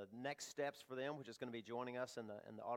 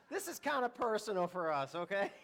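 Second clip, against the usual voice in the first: laughing through the words, higher and louder; 9 semitones higher, 9 dB louder than usual. Real, not acted. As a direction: giggle it out, caught off guard. Pastor laughs over praying for son.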